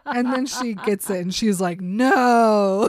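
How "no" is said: sudden loud outcry of realization; shocked cry. Retold or imitated. Bella crying out to the room in loud shock. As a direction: blurt it out loud in horror.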